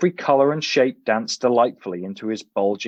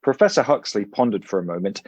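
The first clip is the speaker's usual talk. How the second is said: ordinary delivery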